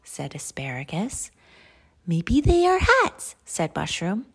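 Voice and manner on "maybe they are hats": high, loud storybook guess; eager guess. Acted. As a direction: pipe up with a bright idea, voice raised high. Mushroom guesses in a read-aloud.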